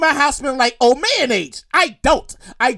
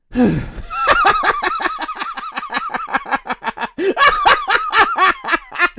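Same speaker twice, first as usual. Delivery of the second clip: cracking up at own joke; high, wheezing run of laughter; long helpless laugh. Real, not acted. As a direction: burst out laughing and keep going.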